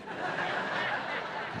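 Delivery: a soft airless laugh at his own joke; breathy laugh. Real, not acted. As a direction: chuckle quietly without voice.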